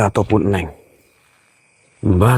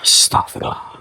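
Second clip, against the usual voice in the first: a breathed astagfirullah; whispered prayer; 3 dB quieter than usual. Real, not acted. Ghost hunter startled by the apparition, whispered prayer. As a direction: gasp it under your breath.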